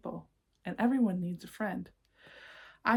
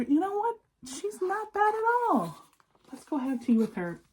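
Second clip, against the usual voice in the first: much higher, bright little bug voices; bugs chatting; 11 semitones higher, 3 dB louder than usual. Acted. The insects tell each other Miss Spider is fine, high chirpy bug voices. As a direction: voice the relieved insects gossiping. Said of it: "saying to each other".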